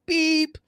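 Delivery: high, loud electronic beep; sharp, high-pitched beep noise; imitated scanner beep. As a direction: mimic an alarm going off. Imitates security scanner going off.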